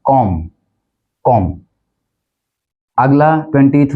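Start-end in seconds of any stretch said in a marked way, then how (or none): 0.1-1.5 कॉम ending on M; कॉम closed on a plain "m"; silent B. Shown as a demonstration. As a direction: drop the B from कॉम. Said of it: "इसको बोला जाता है".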